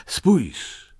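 high, eager look; excited call. Acted. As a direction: tempt him with the globe. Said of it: "zawołał Muminek".

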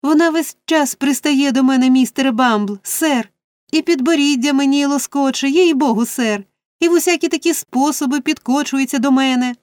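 high-pitched, aggrieved complaint; whining tattling. A narrator's reading. Noah tells on Charlotte to Mr Bumble, whining. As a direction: plead your case in a high whine.

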